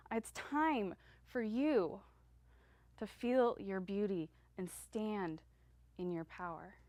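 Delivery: slow and weighty, letting it land; slow, deliberate. Acted. Speaker urging the audience, slow and deliberate. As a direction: stretch each word out slowly.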